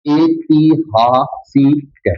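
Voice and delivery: ऐतिहासिक stretched very slowly, each syllable held; drawn out. Shown as a demonstration. Teacher revealing the answer word to students, slowly drawn out. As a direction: dictate it slowly so students can write. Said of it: "तो क्या बन जाएगा?".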